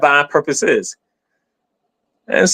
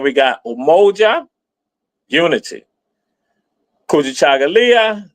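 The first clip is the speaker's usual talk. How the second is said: usual speaking voice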